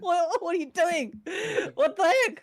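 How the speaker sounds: high, loud cry of "what are you doing"; a crewmate yelping in surprise; startled character voice. Acted. Crewmate yelps at Roger, high and loud.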